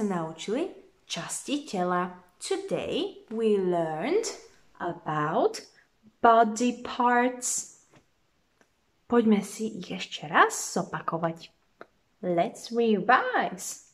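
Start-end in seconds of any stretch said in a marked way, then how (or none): 2.4-7.8 slow teacher voice. Shown as a demonstration. 12.2-13.8 slow teacher voice. Shown as a demonstration.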